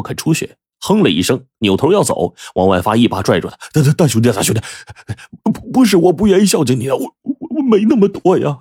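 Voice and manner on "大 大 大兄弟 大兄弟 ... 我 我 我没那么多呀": stammering plea. Acted. Father pleading, stammering.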